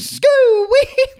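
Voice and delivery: high squealed name; silly name. Pretend. High silly squeal answering a friend's question. Joking made-up teacher name.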